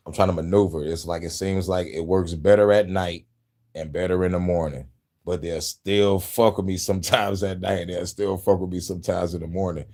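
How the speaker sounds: usual speaking voice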